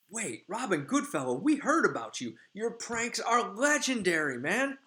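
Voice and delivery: high, loud, gushing admiration; fangirling fairy. Retold or imitated. A fairy gushes to the famous prankster Puck, high and excited. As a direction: gush like meeting your hero.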